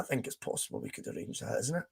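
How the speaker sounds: conspiratorial whisper, half to himself; hushed teasing aside. Real, not acted. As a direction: whisper it like a secret plan. Teasing a guest appearance on stream.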